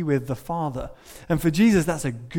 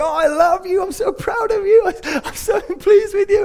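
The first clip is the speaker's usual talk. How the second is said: much higher, louder, beaming with delight; joyful dancing God; 5 dB louder than usual. Retold or imitated. Dancing father God gushing with joy over his children. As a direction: gush over them like a proud dancing dad. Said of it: "And he's like".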